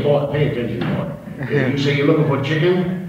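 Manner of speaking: high, slow southern drawl; southern rooster. Acted. Rooster schooling the hawk in a southern drawl. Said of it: "Only I made him a southern chicken".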